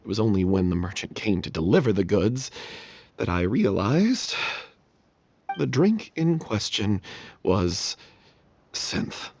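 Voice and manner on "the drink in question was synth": slow, dropping reveal ending in a hushed synth; dramatic reveal. Acted. Confessing the drink to the troupe, slow hushed reveal. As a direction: draw it out to the final word.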